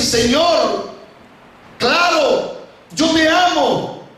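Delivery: high-pitched, drawn-out declaration; heightened, earnest reply; fervent avowal. Acted. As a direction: profess it fervently, pitch soaring. Peter eagerly professes love to Jesus.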